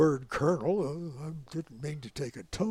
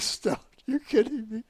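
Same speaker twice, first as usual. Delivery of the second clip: amused disbelief; 7 semitones higher, 4 dB louder than usual. Retold or imitated. Laughing general in disbelief.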